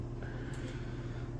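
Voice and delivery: long breathy sigh. Real, not acted. Man recording himself realizes he rambled, long sigh.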